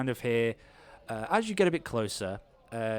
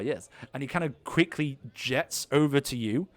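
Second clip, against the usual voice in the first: ordinary talk, nothing marked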